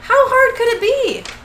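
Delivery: high-pitched defensive protest; hammed-up exasperated confession; mock whining excuse. Quoted Metatron whining his excuse.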